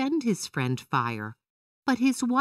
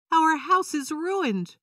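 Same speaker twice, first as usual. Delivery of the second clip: dismayed wail; 11 semitones higher, slower than usual. A narrator's reading. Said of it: "Leopard's wife said".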